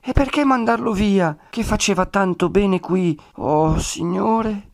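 high, pleading woman's complaint; plaintive protest. A narrator's reading. Woman protesting the friar being sent away, plaintive protest. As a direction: complain high, ending in a drawn-out lament.

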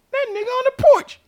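very high-pitched, quick squeal; retold alarm. Spotting the dog up on the porch, high mock alarm.

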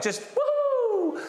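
high, drawn-out whoop; high slow excited cheer; cheery woohoo. Retold or imitated. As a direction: coax with a big whoop. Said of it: "at the end I was like".

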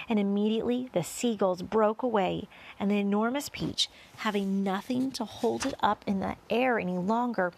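usual speaking voice

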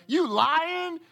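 high, loud, all-out preaching; preaching hard. Real, not acted. Preacher calling out the congregation, loud and high. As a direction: call them out at full force. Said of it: "preacher ramping up".

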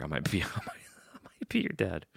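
hushed, voiceless playful aside; joking whisper. Pretend. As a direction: whisper it like a secret joke.

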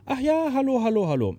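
hoch und erfreut begrüßt; begrüß den Anrufer überschwänglich; freudige Begrüßung. Retold or imitated. Sängerin am Telefon erfreut. (Sängerin begrüßt den Anrufer am Telefon, hoch und erfreut)

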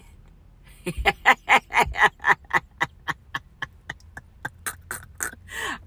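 loud laughter dying away breathlessly; long cackling laugh. Real, not acted. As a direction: laugh hard until the air runs out. Long laugh mid-rant.

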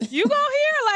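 high, surprised "you go here"; surprised question. Retold or imitated. Retelling meeting her friend at work, high surprised. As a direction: act out spotting an old schoolmate. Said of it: "we was like".

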